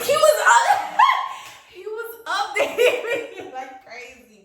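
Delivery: high, loud laugh breaking through the words. Real, not acted. Laughing to viewers about his nonstop updates. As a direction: crack up while telling it.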